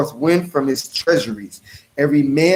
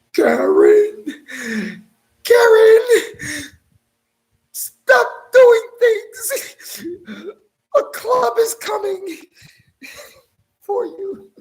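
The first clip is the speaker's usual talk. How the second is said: panicked Karen; slower than usual. Retold or imitated. Preacher mocking a frightened enemy, high panicked wail. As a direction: wail in mock terror.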